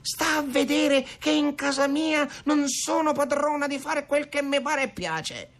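high-pitched strident rant; comare Venera. A narrator's reading. Mother insisting she rules her own house, strident. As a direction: rail indignantly, very high all through. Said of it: "strepitava ancora comare Venera".

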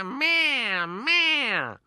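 high meowing cat sounds. A narrator's reading. Fat cats leap on the table, high meowing. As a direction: meow like a big fat cat. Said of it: "facendo mea".

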